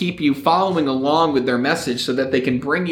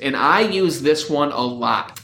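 ordinary delivery

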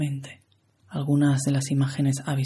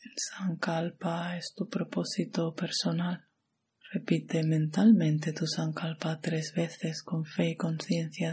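guided meditation; 2 semitones higher than usual. Acted. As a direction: guide the listener into relaxation, unhurried and even.

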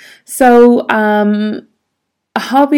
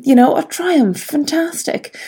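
delighted; 6 semitones higher than usual. Retold or imitated. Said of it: "And I was delighted".

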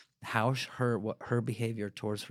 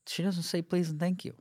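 higher-pitched mock parent complaint; complaining parent; 7 semitones higher than usual. Imitating a parent grumbling about a child's manners, high mock complaint. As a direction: put on a parent's higher voice, griping.

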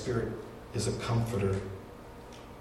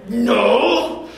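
put-on a sharp, higher, louder child's no; sulky refusal; 10 semitones higher, 10 dB louder than usual. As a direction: snap it out like a grumpy little boy. Angry kid refusing mom's offer.

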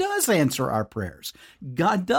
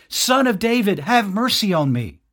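higher and louder, pleading hard; crying out more; 8 semitones higher, 5 dB louder than usual. A narrator's reading. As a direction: shout it, the beggar won't be silenced. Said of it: "he kept crying out all the more".

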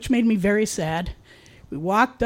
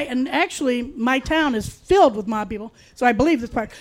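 ordinary talk, nothing marked

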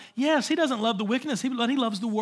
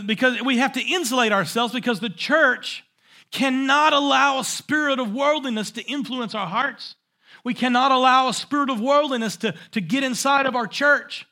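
louder, higher, building preacher cry; preaching at full force; 4 semitones higher, 5 dB louder than usual. Real, not acted. Preacher rousing the church against worldliness, forceful. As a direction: drive it home to the church.

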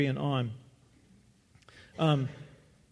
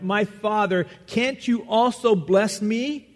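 a loud, pleading cry, higher and slow; 7 semitones higher, 6 dB louder, slower than usual. Acted. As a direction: beg the father through tears, loud and high. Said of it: "and he cried".

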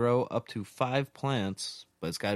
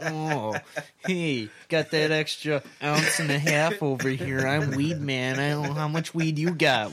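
bragging weed man; 6 semitones higher, 7 dB louder than usual. Pretend.

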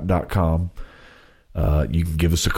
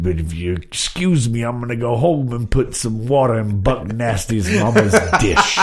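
quoted movie line; 5 semitones higher than usual. Retold or imitated. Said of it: "one of my favorite lines".

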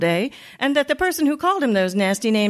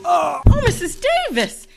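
shocked cry; 7 semitones higher than usual. Acted. Another victim knocked out.

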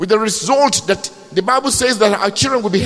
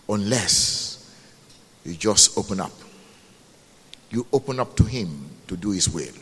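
low, hushed, unhurried assurance; tender reassurance; 11 semitones lower, 5 dB quieter than usual. Real, not acted. Preacher assuring the congregation that God cares, tender reassurance. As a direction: drop right down and comfort them gently.

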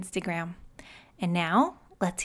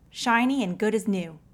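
ordinary delivery

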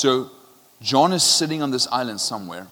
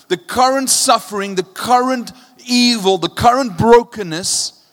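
impassioned preaching, higher and louder; preaching at full force; 7 semitones higher, 8 dB louder than usual. Acted. Preacher pressing hopelessness on the congregation, impassioned and loud. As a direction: build the despair up and let it ring out.

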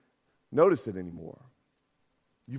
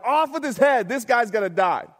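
irate king; 5 semitones higher, 3 dB louder than usual. Retold or imitated. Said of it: "And David's irate".

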